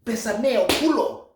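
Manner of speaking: very high, loud Spanish taunt; gleeful loud foreign taunt; forceful Spanish insult. Acted. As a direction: hurl it out high and loud, showing off.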